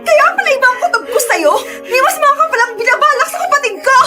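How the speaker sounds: high-pitched accusation through sobs; outraged, voice high with tears; tearful rage. Acted. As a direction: accuse him, crying with fury. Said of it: "Galit na galit talaga ako".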